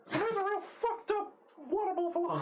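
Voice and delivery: very high, tiny fairy voice; pixie voice. Acted. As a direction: squeak it out like a pixie. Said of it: "Is that your pixie voice?".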